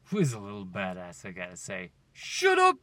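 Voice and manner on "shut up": high, loud outburst; sudden shout. Real, not acted. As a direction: yell it way up high. Mock outburst at himself.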